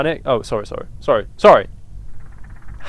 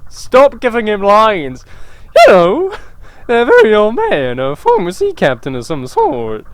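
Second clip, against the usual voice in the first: worried mother voice; 7 semitones higher than usual. Acted. Mother worrying the boy is affected, high voice. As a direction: fret about the boy in a high voice. Voicing parent characters.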